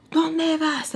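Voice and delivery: high-pitched yelled question, not full volume; mock scream. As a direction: call after someone in a high mock scream. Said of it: "like, screaming".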